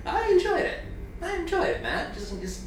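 high whiny mock protest; mock a defensive friend. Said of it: "you're just like".

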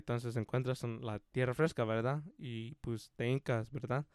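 soft, low Spanish instructor; Don Verna. Retold or imitated. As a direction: imitate quiet, rambling instructions that keep checking with verdad. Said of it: "And then he'd be like".

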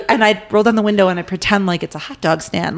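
ordinary delivery

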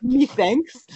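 high-pitched hammed-up Irish; bad Irish accent. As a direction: put on a terrible Irish accent. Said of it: "it really got really bad".

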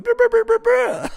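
imitated blubbering. Retelling his camp meltdown, hammed-up sobbing noise.

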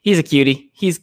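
ordinary talk

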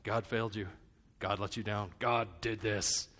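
lightly voiced accuser, pitched low; accusing inner voice. The voice of doubt blaming God, low and lightly voiced. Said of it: "I know the voice".

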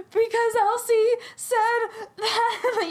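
high, hammed-up tearful wail; imitating someone crying too hard to explain; mock sobbing. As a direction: blubber the explanation through fake tears. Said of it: "Why are you all crying down here?".

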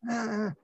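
high, strained ah sounds from a patient who can't speak; imitated panicked grunts. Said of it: "I said, "What is it?".

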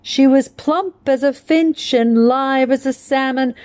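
"She was plump as a finch" flowing in the poem, plump and finch stressed in rhythm; poem recitation. Acted. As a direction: let the line bounce in its anapestic lilt.

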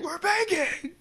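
falsetto plea, squeaky high; high pitched mock begging; high begging daughter-in-law. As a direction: beg in a tiny cartoon voice. Son's wife begging.

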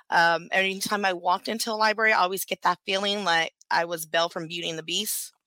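ordinary delivery

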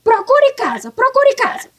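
sharp, insistent command to leave; angry repeated demand; ordering out. Acted. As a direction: tell him to get out of your house. Mother-in-law ordering him out.

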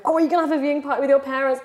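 mock excited friends. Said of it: "all my friends like".

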